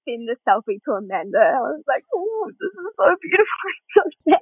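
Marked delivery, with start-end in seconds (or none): 2.1-4.4 high, hammed-up weepy gushing; mock wail breaking into a laugh; imitated crying. Tearful selfie message to a friend, mock weepy. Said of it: "I was crying".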